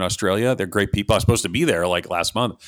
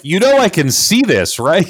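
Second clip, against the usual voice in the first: mock-indignant, higher and louder; indignant retort; 8 semitones higher, 6 dB louder than usual. Host retorting to critics in the chat, mock-indignant. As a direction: call out the chatters, voice pitched up.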